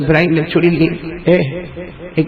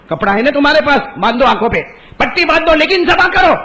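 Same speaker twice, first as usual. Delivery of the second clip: louder, higher insistent command; stern command; 8 semitones higher, 4 dB louder than usual. Acted. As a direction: order it firmly and insistently. God's command voiced.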